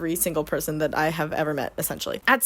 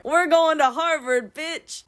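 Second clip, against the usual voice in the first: smug brag; 7 semitones higher, slower than usual. Acted. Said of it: "and he goes".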